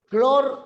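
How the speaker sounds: CLOR high and loud, cut off from the rest of the word; prefix split off. Shown as a demonstration. Teacher splitting a word at the line's end, CLOR high, loud and separate. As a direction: punch CLOR as a piece on its own. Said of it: "al otro lado".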